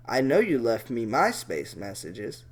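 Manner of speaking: low creepy voice by mistake; wrong voice. Shown as a demonstration. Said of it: "wrong fucking voice again".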